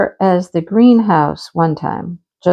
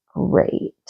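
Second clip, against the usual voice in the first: sarcastic deadpan; 7 dB quieter than usual. Retold or imitated. Sarcastic reply to hawk kill.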